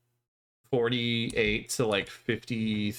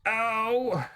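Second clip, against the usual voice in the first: high sharp ow of pain; 9 semitones higher than usual. Real, not acted. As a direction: yelp from a knocked elbow. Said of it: "I just hit my funny bone".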